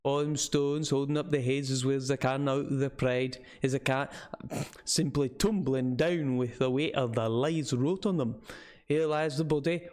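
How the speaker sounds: put-on rambling old local in thick northern English; northern English with Scottish leanings, rustic lilt; northern English dialect. Old man mocking lying tombstones, northern English dialect. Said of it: "like a very northern English".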